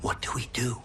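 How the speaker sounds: hushed, worried whisper; a tense whispered question. Acted. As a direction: whisper so the strangers can't hear. Hiding from threatening strangers.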